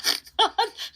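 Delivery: high, bright burst of laughter. Real, not acted. Laughing at his own story.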